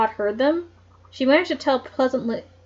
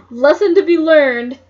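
louder, slow, laughing through it; 5 semitones higher, 10 dB louder, slower than usual. Real, not acted. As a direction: giggle at the silly rule. Laughing aside on the book.

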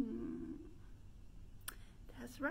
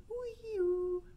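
high wailing wee-oo siren; siren noise; 9 semitones higher, 5 dB louder than usual. Shown as a demonstration. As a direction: wail like an ambulance. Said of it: "push your lips out and go wee-oo".

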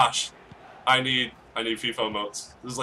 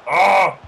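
loud, higher cry of "ah"; outburst; 6 semitones higher, 11 dB louder than usual. Real, not acted. Player reacting to the match, sudden loud cry. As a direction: cry out at the play.

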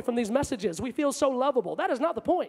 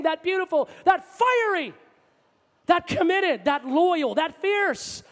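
raised, driving litany of praise; fervent preaching; 5 semitones higher, 3 dB louder than usual. Real, not acted. Preacher tells congregation who God is, fervently. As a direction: pile up each quality with full pulpit fervor. Preaches a mounting list at full force.

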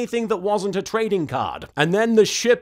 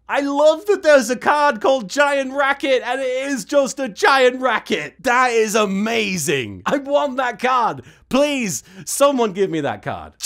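louder, higher, thrilled and eager; gushing excitement; 3 semitones higher, 3 dB louder than usual. Real, not acted. Gushing over a favourite card.

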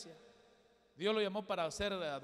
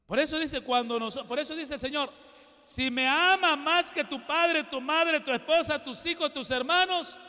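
full-force preaching; 10 semitones higher, 12 dB louder than usual. Acted. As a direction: hammer the point home to the congregation.

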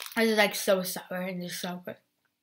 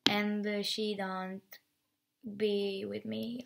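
ordinary talk, nothing marked

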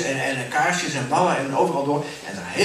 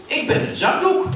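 much higher, louder, laughing handkerchief voice; smug dirty handkerchief; 7 semitones higher, 4 dB louder than usual. Acted. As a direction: show off to the newcomer. Vieze zakdoek spreekt. (hoge, luide stem van de vieze zakdoek)

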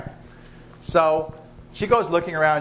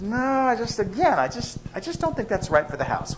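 wife's voice; 6 semitones higher than usual. Acted. Wife refusing the boa constrictor.

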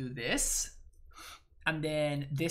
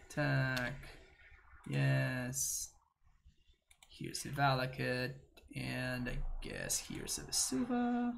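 ordinary delivery

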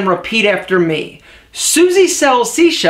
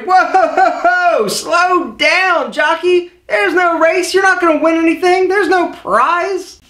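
mock scolding; 6 semitones higher, slower than usual. Scolding himself for talking fast.

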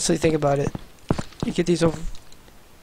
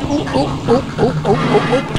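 nervous yelps; 9 semitones higher than usual. Real, not acted. Startled by game threat.

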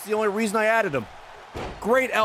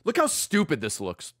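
usual speaking voice

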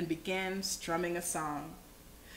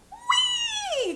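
very high-pitched squeal; long, soaring storybook whee; gleeful cry. A narrator's reading. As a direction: squeal it like a kid on a galloping horse. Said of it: "cried Bob".

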